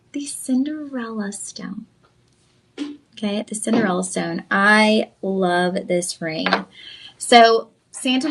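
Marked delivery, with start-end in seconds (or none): 0.1-1.9 slow, soft, hushed unveiling of the name; dramatic reveal. Shown as a demonstration. Host teasing the ring's name to her viewers, slow and hushed. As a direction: let the name land slowly and quietly, like a secret. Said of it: "what I call".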